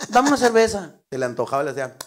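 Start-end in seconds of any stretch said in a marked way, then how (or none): 0.1-0.9 loud, high-pitched bar order; medio gritón. Acted. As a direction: call the order out loudly. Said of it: "así medio gritones". (pidiendo a gritos, norteño)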